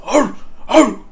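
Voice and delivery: high, loud barking yelps; dog barks. Retold or imitated. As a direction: yelp like a dog. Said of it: "doing my dog barks".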